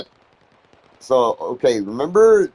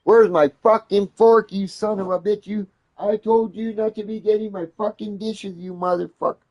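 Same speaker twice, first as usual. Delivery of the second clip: replaying his own furious rant, pitched up; retold rant; 7 semitones higher than usual. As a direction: go off on her about the missing fork. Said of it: "I start going off on Lita".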